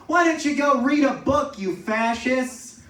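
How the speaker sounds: loud, high mock outburst at the window; hammed-up furious professor, slowing on fascists; angry retort. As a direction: yell it at the trucks outside like an outraged teacher. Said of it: "that was her reaction".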